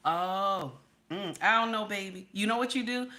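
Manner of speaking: usual speaking voice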